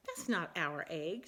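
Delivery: Missus Bird. Acted. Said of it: "said Missus Bird".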